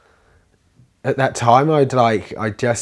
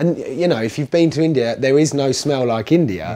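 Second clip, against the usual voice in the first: worked up; 6 semitones higher, 10 dB louder than usual. Real, not acted. Still worked up about India.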